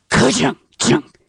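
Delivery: mechanical clunk sound done by mouth; mouthing the clunk of a cassette changing sides; imitated tape click. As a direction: make the noise of a boombox flipping a tape. Imitating tape deck flipping.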